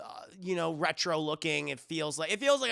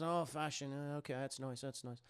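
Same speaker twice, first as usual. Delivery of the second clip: much softer, trailing off, dismissive; unimpressed murmur; 3 semitones lower, 11 dB quieter than usual. Retold or imitated. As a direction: mumble it as if not interested. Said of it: "She goes".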